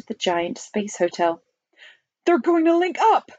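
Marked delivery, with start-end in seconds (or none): 2.2-3.3 high, excited shout. A narrator's reading. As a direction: call it out high and urgent. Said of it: "shouted the president".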